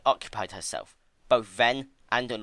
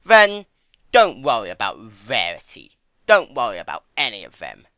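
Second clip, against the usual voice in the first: much louder, cold dismissal; louder menacing trickster voice; cruel; 12 dB louder than usual. A narrator's reading. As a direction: turn suddenly cruel. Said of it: "Discord's mouth took a cruel set".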